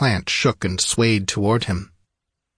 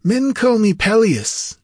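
high, slow, louder declaration; grand high-pitched wizard introduction; sorcerer announcing himself; 13 semitones higher, 5 dB louder than usual. A narrator's reading. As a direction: proclaim your name high and drawn out. Sorcerer reveals his name.

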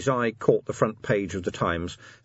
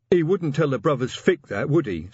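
higher-pitched troll taunt; menacing troll officer; 7 semitones higher, 4 dB louder than usual. A narrator's reading. Troll officer taunts the lone messenger, grinning horribly. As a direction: needle him with a nasty grin in the voice. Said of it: "grinning horribly".

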